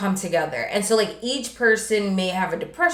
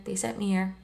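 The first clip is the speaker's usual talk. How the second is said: soft-spoken sweater; 8 dB quieter, faster than usual. Retold or imitated. Grieving sweater offering the son a hug, really softly. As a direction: keep it very gentle and quiet. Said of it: "He talks really softly like this".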